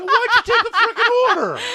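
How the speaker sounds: high, loud comic outburst; hammed-up indignant shout; exasperated yell. Husband re-enacting outrage at the waitress, exasperated mock yell.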